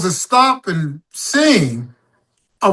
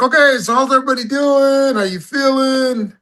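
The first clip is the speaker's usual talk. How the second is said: much higher emcee voice to the crowd; stage host banter; 9 semitones higher than usual. Retold or imitated. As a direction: greet the crowd like a showman stalling. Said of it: "So I was like".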